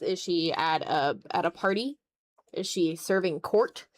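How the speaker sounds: usual speaking voice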